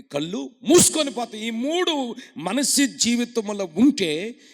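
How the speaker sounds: loud, drawn-out sermon cry; fervent preaching. Acted. Preacher reading scripture aloud to the church, fervently. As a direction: stretch each phrase like a prophet.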